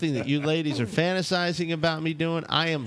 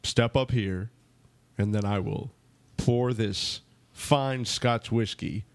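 lightly voiced presidential cadence, lower and halting; Obama impression; 5 semitones lower than usual. Host serving shots to ladies, mild Obama impression. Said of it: "I'm gonna do it like Obama".

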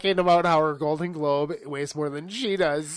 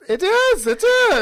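high, loud gleeful burst; big high-pitched yes-burst; excited agreement; 11 dB louder than usual. Real, not acted.

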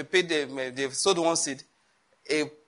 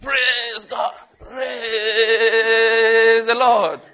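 hammed-up high churchgoer shouting praise, last words dragged out slowly; mock the gullible believer at full cry; high, loud parody of a shouting worshipper; 5 semitones higher, 6 dB louder, much slower than usual. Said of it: "The man will now go to church".

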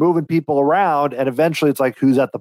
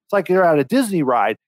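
usual speaking voice

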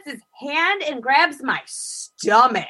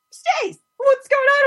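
much higher, alarmed question; startled friend. Retold or imitated. Friend finding her in his house at night, startled and high. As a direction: sound caught off guard, pitched way up. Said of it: "Nat comes out and he's just like".

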